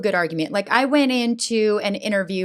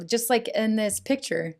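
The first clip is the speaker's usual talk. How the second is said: ordinary talk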